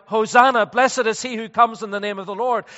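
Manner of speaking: loud, high mock crowd shout; preacher loudly imitating a cheering crowd; retold crowd cry. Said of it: "screamed out".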